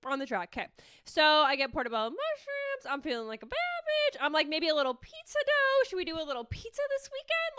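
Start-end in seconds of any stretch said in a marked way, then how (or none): 2.9-4.2 singsong high-pitched self-hype; playful high voice. Acted. Playful boast while shopping. 4.5-7.6 very high, cutesy self-talk; high singsong musing; playful high voice. Acted. Acting out excited self-talk.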